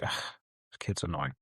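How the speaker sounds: low, quiet mock grumble; fed up. Dad about his pestering son, fed up. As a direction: grumble it softly like a worn-out dad. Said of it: "he's just fed up".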